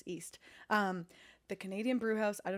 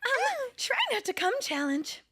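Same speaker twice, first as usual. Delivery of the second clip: high, slow sweet princess voice; 13 semitones higher, slower than usual. Retold or imitated. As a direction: sing it like a cartoon princess. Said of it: "you're doing a Princess voice".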